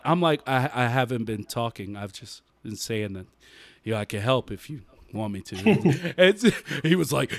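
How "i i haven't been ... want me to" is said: low, soft, patient reply; much softer, low, unbothered voice; calm quiet reply. Retold or imitated. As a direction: keep it flat and patient.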